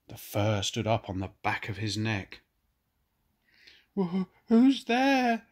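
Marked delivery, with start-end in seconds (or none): 4.0-5.5 high, slow, stammering call; nervous call. Acted. Fergus calling from his kennel at night, high nervous stammer. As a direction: quaver it out like a frightened dog. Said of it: "he called out nervously".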